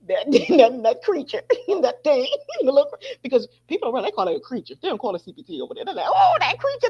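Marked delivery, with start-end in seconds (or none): 6.1-6.9 mock alarm. Acts out people's alarmed reaction.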